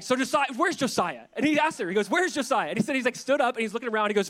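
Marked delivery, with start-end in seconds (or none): 0.0-1.3 imitating a frantic demand, louder and higher; mock alarm, pitched up; paranoid freak-out. The man demands answers from a stranger, paranoid. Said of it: "he's all paranoid and freaked out". 2.1-2.7 mock frantic question, loud and high; paranoid freak-out. The man questions the young girl, paranoid. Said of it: "he's all paranoid and freaked out".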